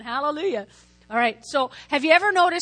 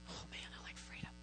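whisper; 27 dB quieter, faster than usual. Real, not acted. Aside after asking congregation.

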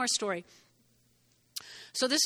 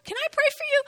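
much higher, sweet offer to pray; friendly offer. Shown as a demonstration. Preacher showing how to ask a stranger, in a high sweet voice. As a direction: ask it lightly, like a kind stranger. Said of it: "But ask them".

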